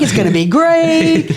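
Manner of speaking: high, sing-song sales cheer; mock upbeat. Mocking her own upbeat pitch.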